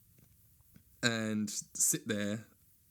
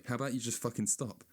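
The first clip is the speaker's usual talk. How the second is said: normal talking voice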